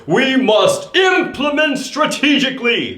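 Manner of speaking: warrior voice. Shown as a demonstration. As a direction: proclaim it like a warrior charging. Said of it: "say it like a warrior".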